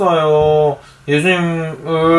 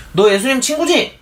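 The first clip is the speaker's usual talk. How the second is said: lightly voiced girl, higher pitch; questioning servant girl; 9 semitones higher than usual. A narrator's reading. Girl at the door asks Peter, lightly voiced questioning. 소녀가 베드로에게 묻는 장면.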